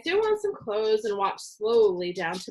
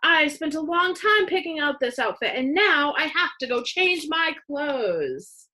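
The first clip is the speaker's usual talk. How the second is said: angry complaint; 7 semitones higher, 4 dB louder than usual. A narrator's reading. Angry sister after spilled milk.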